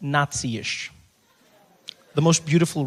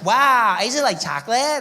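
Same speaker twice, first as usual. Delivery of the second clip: put-on high, louder wide-eyed American girl; valley girl; 6 semitones higher, 5 dB louder than usual. Naive American girl hears chocolate claim, high wow. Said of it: "She's like".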